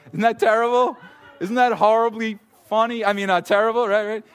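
high, loud and laughing through the words; cracking up at his own grim story. Real, not acted. Pastor laughing to the congregation about crushed ducks, high and loud.

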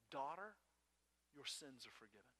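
forgive her gently, like a father; Jesus speaking softly to the healed woman; tender blessing. Retold or imitated.

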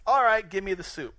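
loud, mock-impatient giving in; impatient Esau. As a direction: blurt it out as a hungry, hasty demand.

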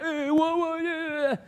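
high, wobbling mock-scared wail; cowering. Scared soldiers facing the giant, mock cowering. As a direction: play soldiers quailing in fear. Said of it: "they are cowering".

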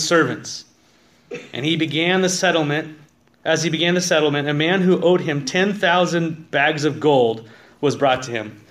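loud, driving reading of the parable; forceful scripture reading. Acted. Pastor reads the parable to his congregation, loud preaching. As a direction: read it out like you're preaching to the back row.

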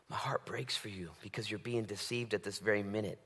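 hushed, aching whisper; heartbroken whisper. Pretend. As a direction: lean in and whisper it with grief. God speaking to the sinner.

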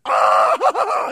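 put-on piercing high child shriek; mock child screaming over a scratch; child's scream. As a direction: shriek like a kid with an owie. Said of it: "they are screaming like their arm is cut off".

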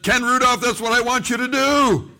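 high, loud commanding call; forceful proclaimed command from above; Holy Spirit. Retold or imitated. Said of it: "the Holy Spirit said".

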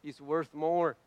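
ordinary talk

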